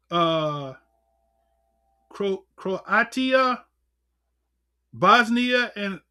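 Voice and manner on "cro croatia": normal talking voice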